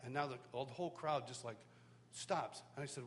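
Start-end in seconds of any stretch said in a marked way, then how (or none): none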